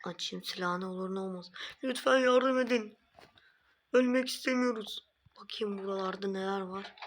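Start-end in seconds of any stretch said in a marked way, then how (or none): 1.8-5.1 pleading villager voice. Acted. Trapped villagers begging for help.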